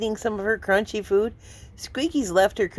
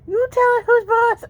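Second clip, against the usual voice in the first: higher and louder cheering on; excited pet talk; 12 semitones higher, 5 dB louder than usual. Real, not acted. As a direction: pump the kitten up. Cheering kitten on.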